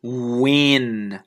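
"wing" stretched from oo to a long ng; careful word demo. Shown as a demonstration. Teacher giving examples of the w sound, slow stretched demonstration. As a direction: hold "wing" slowly from the oo onset. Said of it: "下面其他的一些例子".

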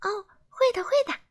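very high elf voice; high cheeky fairy voice; grinning elf. A narrator's reading. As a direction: promise it with a sly grin. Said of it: "小精灵咧嘴笑了笑".

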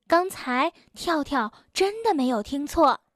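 ordinary delivery

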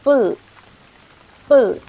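bu，bu said tense and loud, like a falling fourth tone; tense stressed demo. Shown as a demonstration. Teacher showing the tense consonant, loud and forceful. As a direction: punch bu hard, tight and loud. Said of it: "发音呢是重读就可以了".

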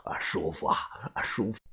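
low, soft unvoiced murmur of relief; whispered sigh of relief while scratching; muttering to himself. A narrator's reading. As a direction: breathe it out half to himself. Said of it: "自言自语地说道".

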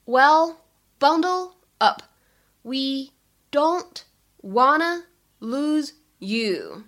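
slow, separate words with pauses between; deliberate language-teacher breakdown. Shown as a demonstration. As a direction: stretch out each word, pausing after it. Teacher breaks line down word by word.

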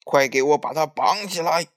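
fierce, forceful command; shouted order. A narrator's reading. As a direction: shout the order to your troops. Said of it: "大叫一声".

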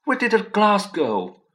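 high, loud bewildered question; lost and baffled, asking again; shocked keeper. A narrator's reading. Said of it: "he kept saying".

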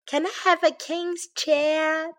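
high-pitched pleading little bear; begging. A narrator's reading. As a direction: ask like a child begging for a treat. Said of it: "begged Baby Bear".

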